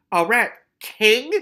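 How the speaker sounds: high, loud, drawn-out question; sharp. A narrator's reading. Keith questioning the rat catcher's confession, sharply. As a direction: demand it sharply, in disbelief. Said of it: "said Keith sharply".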